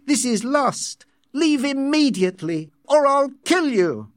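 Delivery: high-pitched, angry outburst; furious threat. A narrator's reading. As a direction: build from outrage to a shouted threat. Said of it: "John looked so angry".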